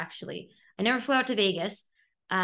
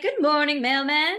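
higher and louder, sing-song hello; greeting the mailman in a cheery, higher, louder call; 8 semitones higher, 7 dB louder than usual. Retold or imitated. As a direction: call out brightly across the street.

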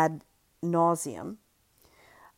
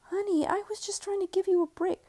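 reassuring. Acted. Husband soothes wife.

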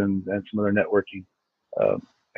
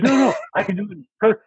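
imitating deaf friend; 9 semitones higher, faster than usual. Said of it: "He goes".

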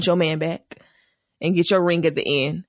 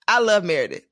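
ordinary delivery

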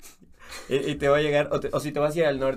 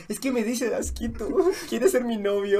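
higher, slow giggly flirt; mock smitten, laughing; 7 semitones higher than usual.

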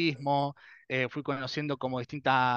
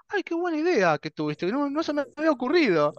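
high-pitched, hammed-up delighted discovery; mock amazement; 11 semitones higher, 3 dB louder than usual. Answering own idea about the registers, mock amazement. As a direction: act astonished by your own obvious idea, pitched way up.

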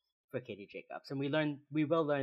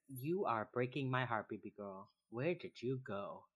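soft tender quote; 5 dB quieter than usual. Retold or imitated. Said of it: "And then Derek says".

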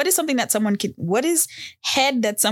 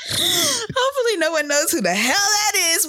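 laughing; 12 semitones higher than usual. Real, not acted. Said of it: "oh shit".

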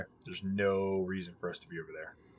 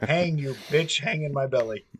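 mock possessed woman; 5 semitones higher, 5 dB louder than usual. Woman holding the demon in.

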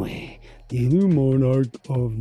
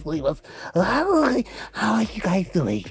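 squeaky goofy voice with high shrieks; Clevis character voice; 7 semitones higher than usual. Acted. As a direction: play a dim-sounding oddball, pitch leaping. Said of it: "what Clevis is supposed to just say".

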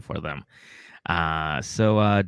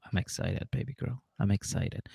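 much softer, murmured excitement; quiet little pet talk; hushed aside to a pet; 11 dB quieter than usual. Retold or imitated. Retelling own words to the dog.

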